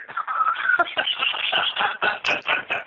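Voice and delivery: a long high-pitched wheezing laugh; cracking up at his own punchline; real, breathy laughter; high breathless laugh. Real, not acted. Laughs after his dinner-table joke.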